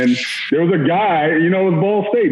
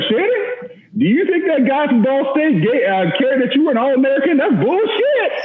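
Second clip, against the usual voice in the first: high, mocking coach voice, much higher pitched; impression of Coach Spurrier; 10 semitones higher than usual. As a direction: scold the player in a high nasal-free squeak of mockery. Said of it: "and says just like this".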